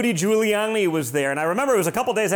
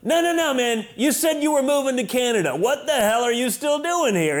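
retold yell; 6 semitones higher than usual. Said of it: "I was yelling across the room".

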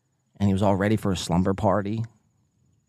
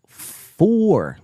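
mock golf yell; 7 semitones higher, 4 dB louder than usual.

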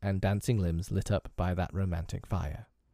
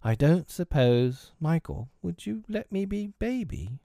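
timid request; 8 semitones higher than usual. A narrator's reading. Timid Tootles asks again.

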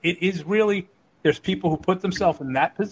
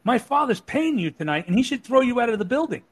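high-pitched retold telling-off; imitated feisty scolding; 7 semitones higher, 3 dB louder than usual. Twelve-year-old daughter telling off a wrestler, imitated scolding. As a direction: imitate a fearless kid laying down the law, pitched up.

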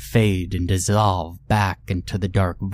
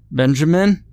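higher, louder call; 7 semitones higher, 5 dB louder than usual. Acted. Father calling for his lost son, loud call. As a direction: call out for him into the dark. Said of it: "I called out".

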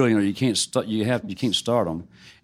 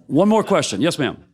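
normal talking voice

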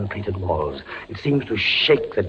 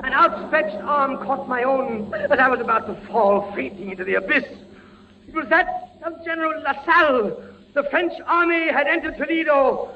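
higher, urgent frantic narration; rising terror. A narrator's reading. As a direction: build panic then relief. Narrating final terror and rescue.

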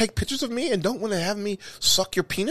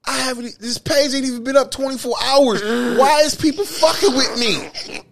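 mock whiny complaint; 6 semitones higher, 3 dB louder than usual. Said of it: "I'm like".

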